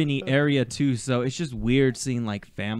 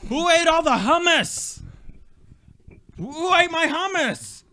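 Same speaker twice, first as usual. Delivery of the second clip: yelling across the room; 14 semitones higher, 4 dB louder than usual. Pretend. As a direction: call out across the house. Suburban dad yelling.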